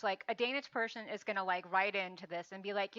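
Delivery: normal talking voice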